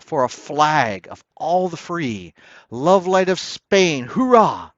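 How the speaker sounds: exultant rising cry; triumphant cheer. A narrator's reading. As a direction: cheer him on as he rides out. Triumphant cheer for Don John.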